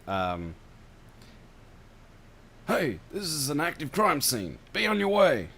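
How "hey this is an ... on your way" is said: high-pitched commanding call; yelling cop. Acted. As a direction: shout them off the crime scene. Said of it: "yells in a thick Brooklyn accent".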